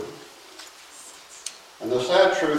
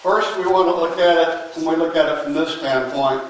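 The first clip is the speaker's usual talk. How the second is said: ordinary delivery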